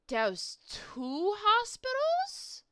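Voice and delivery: high, slow, bewildered; baffled creature. Acted. Creature baffled by the doctor's question, slow and high. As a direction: stretch the question out in disbelief.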